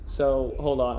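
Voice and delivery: plain reading voice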